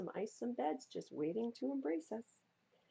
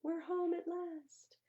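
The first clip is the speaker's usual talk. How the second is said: high, slow happy sigh; relieved cry; 10 semitones higher, slower than usual. Acted. Family arriving home at last, high relieved cry. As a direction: sing out the relief.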